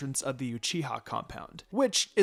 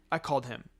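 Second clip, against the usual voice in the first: plain reading voice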